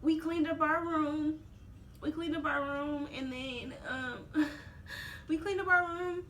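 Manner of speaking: high, tearful voice; crying. Real, not acted. Mother recalling leaving the hospital, crying. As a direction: push the words out through tears. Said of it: "Why are you crying?".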